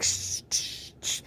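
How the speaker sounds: breathy unvoiced shushing; shushing in a whisper to the viewers. Shown as a demonstration. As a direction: hush like in the Bruno song. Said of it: "I'm here just like".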